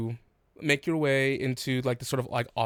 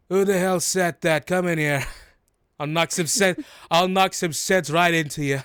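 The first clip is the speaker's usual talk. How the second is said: loud, angry yell from the next room; 4 semitones higher, 6 dB louder than usual. Acted. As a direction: bark it out like a furious grandma. Grandma yells from the office.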